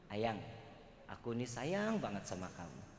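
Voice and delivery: husband whispering love before sleep, tender; tender sweet talk. Shown as a demonstration. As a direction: coo it to your wife at bedtime.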